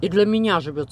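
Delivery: talking with food in mouth; chewing. Real, not acted. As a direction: mouth partly full. Seen 0: fork to mouth at 0.6, jaw working through labelled words.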